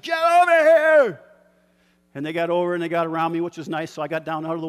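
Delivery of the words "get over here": full-throated yell, much higher and louder; shouted command. Retold or imitated. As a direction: bellow it across a rain-soaked field. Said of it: "And I screamed".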